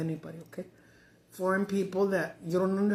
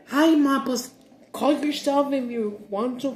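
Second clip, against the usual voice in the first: loud, higher snapping imitation of the daughter; angry retort; 4 semitones higher, 6 dB louder than usual. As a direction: snap it back like the annoyed daughter. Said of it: "she'd get mad and say".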